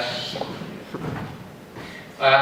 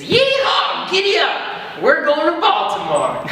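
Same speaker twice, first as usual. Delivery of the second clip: whooping cowboy voice, much higher; mock cowboy; 11 semitones higher, slower than usual. Little man riding your back, whooping cowboy yell. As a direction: yell it like a rodeo rider. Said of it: "jumps on your back and says".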